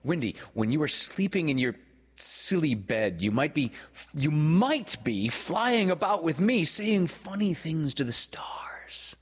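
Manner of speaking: put-on eager boy building in pitch; excited enticement. A narrator's reading. Peter enticing Wendy to fly, building excitement.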